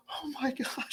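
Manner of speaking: high, laughing "oh my gosh"; overwhelmed gasp. Retold or imitated. Friend greeted at the club, gasping in high surprise. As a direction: caught off guard and touched.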